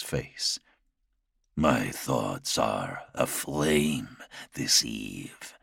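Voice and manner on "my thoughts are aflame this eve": slow, low, haunted confession; low brooding lord. Acted. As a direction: drag it out, dark and weary.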